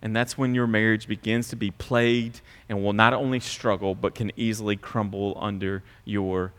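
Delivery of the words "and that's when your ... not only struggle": normal talking voice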